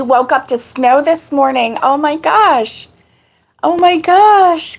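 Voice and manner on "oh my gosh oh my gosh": surprised. Real, not acted. Reacting to snow.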